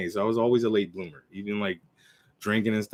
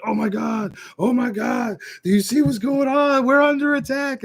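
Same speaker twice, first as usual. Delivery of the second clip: high, frantic panic, voice climbing; imitating friends bursting in panicked; 12 semitones higher, 4 dB louder than usual. Friends rushing home in panic about the attacks, high panicked cry. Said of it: "they're panicking".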